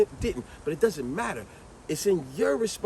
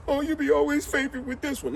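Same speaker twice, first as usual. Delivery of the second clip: high, whiny complaint; whining kid; 9 semitones higher than usual. Retold or imitated. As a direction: complain about a favorite. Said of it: "And say".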